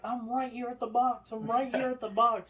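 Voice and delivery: high, pressed repeated plea; mock frantic yell. Trapped lady yelling at the call box, high and frantic. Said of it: "she's like".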